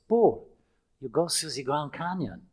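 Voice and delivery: lightly imitated French accent, eager; a touch of French accent. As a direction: play an excitable French guest's accent. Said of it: "a guest coming in from France".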